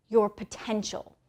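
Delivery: ordinary talk, nothing marked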